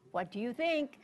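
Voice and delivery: usual speaking voice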